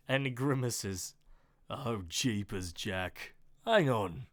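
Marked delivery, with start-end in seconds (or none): 1.7-4.3 deep Australian man. Acted. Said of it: "deep voice Australia man".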